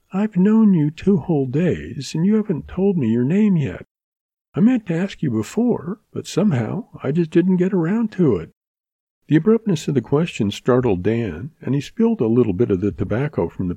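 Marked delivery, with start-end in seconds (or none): 0.1-8.5 higher, lightly voiced girl, curious; a shy young woman asking his name; young woman Mary. A narrator's reading. Mary asking Dan his name, higher girlish voice.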